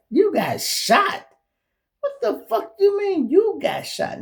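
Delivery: high, loud, stunned question; high-pitched disbelieving shout; shocked disbelief. Retold or imitated. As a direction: act out hearing the shock. Shocked reaction to news.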